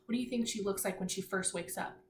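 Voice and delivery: ordinary delivery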